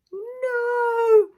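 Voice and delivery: a high, loud cry of no; anguished cry. Acted. A distant victim crying out in a high loud no.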